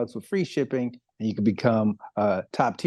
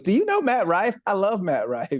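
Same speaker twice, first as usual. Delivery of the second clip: higher-pitched imitation of an eager daughter; lightly voiced daughter; 9 semitones higher than usual. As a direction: lift the pitch to mimic a fan girl. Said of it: "his daughter comes up and she goes".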